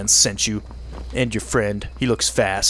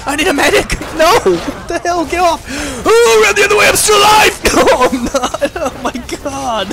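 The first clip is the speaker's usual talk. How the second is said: high, loud frantic shouts; panicked yelling; 12 semitones higher, 5 dB louder than usual. Real, not acted. Gamer panics while monsters attack, shouting frantically. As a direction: scream for help as everything goes wrong.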